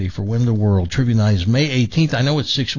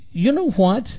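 higher, hurt voice of the upset sister; 6 semitones higher than usual. Acted. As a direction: play the sister, indignant and wounded. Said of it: "She, she becomes hysterical".

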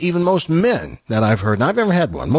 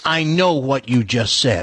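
slow and pointed; slow deliberate; slower than usual. Pretend. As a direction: drag each word out. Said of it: "That's all you need to know how to say".

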